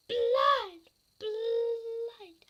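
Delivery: high, very loud drawn-out yell; mock attack shout. Mock attack with blinding camera light.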